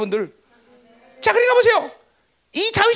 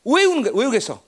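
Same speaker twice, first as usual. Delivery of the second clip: normal talking voice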